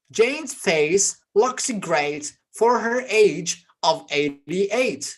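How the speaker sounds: slowed right down, every word laid out; slow model reading. Shown as a demonstration. Teacher reading slowly to students repeating after him. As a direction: read it slowly so students can copy. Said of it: "slow model sentence for repeating".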